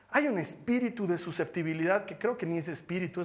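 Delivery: ordinary talk, nothing marked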